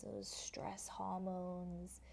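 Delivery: slow, breathy whispered cue; soft whisper. Shown as a demonstration. Teacher whispering a cue to students, slow soft whisper. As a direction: hush it like a secret. Yoga teacher guiding floor work.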